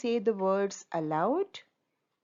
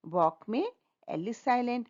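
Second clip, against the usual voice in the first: ordinary talk, nothing marked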